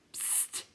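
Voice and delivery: sharp, soft hiss; hushing psst. A narrator's reading. Crowd silencing the clown, soft hiss. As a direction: hush them with a quick psst. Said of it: "all the others went".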